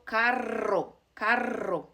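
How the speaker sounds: carro with a trilled rr; strong rolled R. Shown as a demonstration. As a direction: roll carro's double r. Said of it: "it's a strong R sound".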